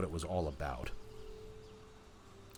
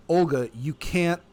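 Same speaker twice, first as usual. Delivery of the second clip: loud, high, slow objection; emphatic protest; 8 semitones higher, 11 dB louder, slower than usual. A narrator's reading. Man arguing with Olga, loud emphatic protest. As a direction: raise the voice and stretch each word. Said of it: "speaking with a strong German accent".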